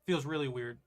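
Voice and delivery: speaking with half a numb mouth. Real, not acted. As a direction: talk through a numb lip. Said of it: "half my mouth is numb".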